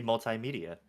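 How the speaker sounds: "multimedia" as mul-tie-media; multi as MUL-tie. Shown as a demonstration. States own chosen pronunciation.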